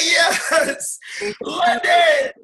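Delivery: high, loud cheer for London; cheering the city on full volume; excited shout. Pretend. Shouting his excitement about London, loud and high. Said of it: "I'm like".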